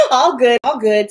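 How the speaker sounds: laughing through the words. Real, not acted. Vlogger reading a viewer comment, laughing as she answers. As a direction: chuckle it out, amused by the comment.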